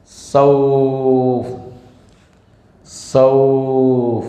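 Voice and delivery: drawn-out sauf sung like a Quran reciter; Sudais style recitation. Shown as a demonstration. Teacher imitates a famous imam's recitation.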